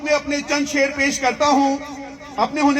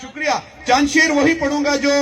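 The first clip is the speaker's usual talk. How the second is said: usual speaking voice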